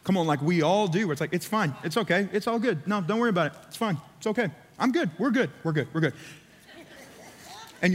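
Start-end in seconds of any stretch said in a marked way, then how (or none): 1.3-6.1 mock-breezy, hollow reassurance; insincere lip service. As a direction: pretend all is fine, without meaning it. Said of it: "with your lips or lip service".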